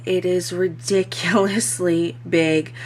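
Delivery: normal talking voice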